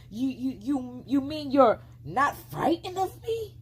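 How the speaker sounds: high, stuttering shock; stammering disbelief. A narrator's reading. Fox shocked the girl isn't afraid, stammering and high. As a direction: sputter it out in shock.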